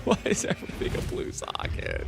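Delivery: low groaning whisper; long-suffering. Pretend. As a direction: growl it out, defeated. Repeat in other voice.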